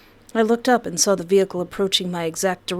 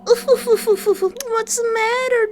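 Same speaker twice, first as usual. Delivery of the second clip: high, drawn-out laughing taunt; mock him while he dies; 13 semitones higher, 3 dB louder, much slower than usual. Real, not acted. Streamer taunting the dying Derek, high mocking laugh. Gloating over dying enemy.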